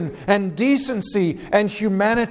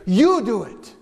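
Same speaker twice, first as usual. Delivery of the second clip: disgusted dismissal; slower than usual. Acted. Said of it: "It reveals his disgust and frustration".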